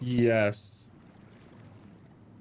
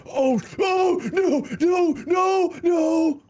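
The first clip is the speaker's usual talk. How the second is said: panicked shouting; slower than usual. Real, not acted. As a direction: yell in rising alarm. Dying in game.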